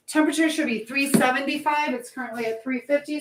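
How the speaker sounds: ordinary talk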